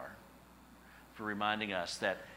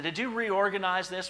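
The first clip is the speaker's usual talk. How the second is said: higher, louder accusing "Did you reorganize this?"; pointed accusing question; 9 semitones higher, 7 dB louder than usual. Retold or imitated. Husband pressing his wife about the missing envelopes, accusingly. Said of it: "I said".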